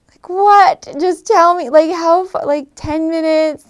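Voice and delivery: high, loud mock bafflement; re-enacted exasperated confusion; baffled. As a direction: press for an answer, incredulous. Acting out her baffled reaction to directions.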